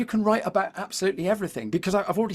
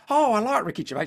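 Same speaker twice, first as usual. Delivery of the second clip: a hint of the wife, slightly raised; wife's voice; 4 semitones higher, faster than usual. Husband quoting his wife on the sofa, lightly voiced. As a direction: lightly put on a different voice for the wife. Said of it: "She doesn't sound like that".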